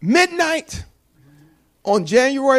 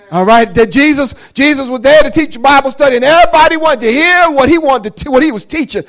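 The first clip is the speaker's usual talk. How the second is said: preaching; 5 semitones higher, 9 dB louder than usual. Acted. Preaching at full force.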